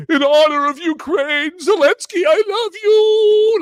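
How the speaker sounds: squealing fake tribute; mock patriotic toast. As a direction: sing out the toast like a gushing fan. Mocking a beer's patriotic pitch.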